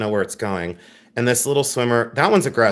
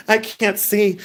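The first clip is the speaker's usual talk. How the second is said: laughing tears; 7 semitones higher, slower than usual. Real, not acted. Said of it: "I go from crying to laughing".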